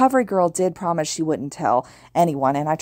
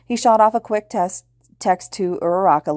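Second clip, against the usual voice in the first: usual speaking voice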